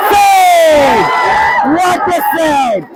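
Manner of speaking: high, drawn-out commentator's shout; excited save call. Real, not acted. Commentator hailing the keeper's penalty save, thrilled high shout. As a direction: cry out the save, stretching each vowel.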